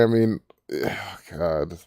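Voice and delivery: usual speaking voice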